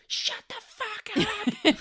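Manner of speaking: high, drawn-out screamed outburst; exasperated yell. Retold or imitated. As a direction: imitate snapping at noisy voices, slow and screeching. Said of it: "talking really loud, and she just goes".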